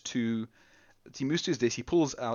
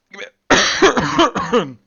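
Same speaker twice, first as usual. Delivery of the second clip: loud, high hacking cough fit; choking cough; 9 semitones higher, 15 dB louder than usual. Acted. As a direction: cough like you're choking on something. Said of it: "Riley suddenly starts choking".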